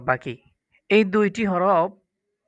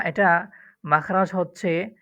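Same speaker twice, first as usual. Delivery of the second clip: usual speaking voice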